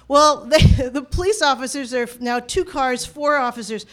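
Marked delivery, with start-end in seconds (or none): none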